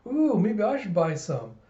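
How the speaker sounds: high, musing ooh; Yor. Acted. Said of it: "silent as yours, like".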